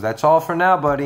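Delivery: a hint of a cartoon character telling a pet that's it; cartoon owner to pet. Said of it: "he looks up and he goes".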